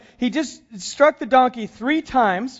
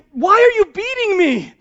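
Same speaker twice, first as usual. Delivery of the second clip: high, louder plaintive donkey voice; raised, aggrieved animal voice; donkey's hurt complaint; 9 semitones higher, 5 dB louder than usual. Acted. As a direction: play the wronged donkey. Donkey voiced in Bible story.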